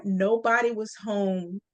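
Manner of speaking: ordinary delivery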